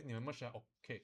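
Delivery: ordinary talk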